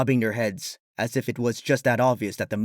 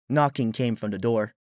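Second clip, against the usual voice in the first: plain reading voice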